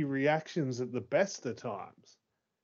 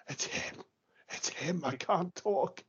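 hushed, breath-caught awe; gasp, then barely get the words out softly; overwhelmed, lost for words; 5 semitones higher, 3 dB quieter than usual. Retold or imitated. Fan telling Eliza it's him, choked up in awe. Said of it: "And I was like".

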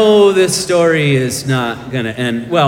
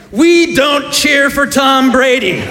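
higher and louder, scolding yell; mock yell; 10 semitones higher, 5 dB louder than usual. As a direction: bark it at the kid in mock fury. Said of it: "I yell at him".

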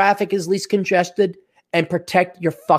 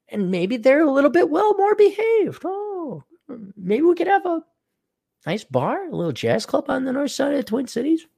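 high, airy sarcastic daydream; sarcastic falsetto; 9 semitones higher than usual. Pretend. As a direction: float it up high, mock wistful.

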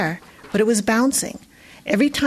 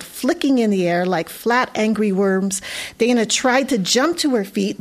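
usual speaking voice

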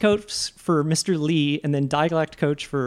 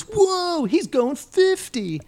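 high, slow, stretched-out amazement; astonished cop imitation; 14 semitones higher, much slower than usual. Host mimics the cop seeing Jet Li run, mock astonishment. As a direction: play a dumbfounded cop, hammed up. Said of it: "And then the cop goes".

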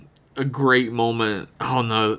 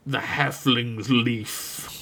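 wizard voice; 4 dB quieter, much slower than usual. Retold or imitated. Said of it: "Gandalf quoting".

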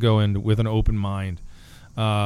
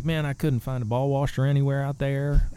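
put-on Southern accent; 4 semitones higher than usual. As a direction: complain like a Southern American tourist. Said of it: "I did a Southern accent".

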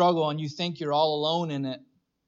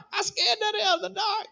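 much higher toddler voice with a put-on accent; son's funny accent. Toddler son to daddy about the dark, high put-on accent. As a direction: squeak it out like a scared little boy. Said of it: "Where did you get that accent?".

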